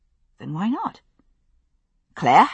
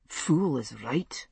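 slow, soft self-mutter; 5 semitones lower, 7 dB quieter, slower than usual. Acted. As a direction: mutter it to yourself, slowly. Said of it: "he muttered to himself".

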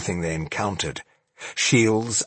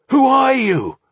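high, loud sharp demand; 7 dB louder than usual. Acted. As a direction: demand an answer. Demanding the band's identity.

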